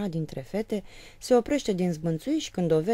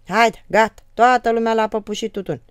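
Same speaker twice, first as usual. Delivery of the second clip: much louder, calling everyone; shout; 6 semitones higher, 11 dB louder than usual. Retold or imitated. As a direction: yell to the whole house. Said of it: "strigă". (strigăt către toată casa)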